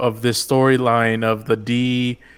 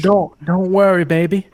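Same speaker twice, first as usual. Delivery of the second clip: higher, lightly put-on British lilt; imitating Alpha's British accent to her daughter; mock British accent; 6 semitones higher, 4 dB louder than usual. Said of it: "that accent she's putting on".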